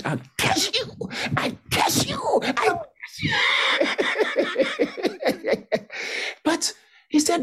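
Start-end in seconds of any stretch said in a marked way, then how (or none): 0.0-3.3 high, hammed-up curse, breaking into a shout; laying a curse. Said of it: "you want to spoil my church?". 3.4-6.4 high breathy laugh. Real, not acted. Laughs after mock curse.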